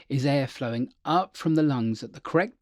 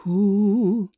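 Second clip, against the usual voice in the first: sagging, unsupported ooh, higher, louder; dropped chest ooh; 6 semitones higher, 5 dB louder than usual. Shown as a demonstration. Coach showing bad support: chest drops on ooh. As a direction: let the chest collapse on the ooh. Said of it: "if the chest drops".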